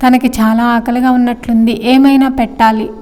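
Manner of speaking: plain reading voice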